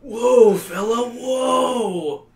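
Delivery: high, strained horse call; calling whoa. Acted. You calling to the horse while pulling the reins. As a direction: haul the horse back, calling out. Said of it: "pull on the reins and scream".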